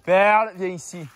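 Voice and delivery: loud, high call to a dog. Real, not acted. Calling the dog Perle.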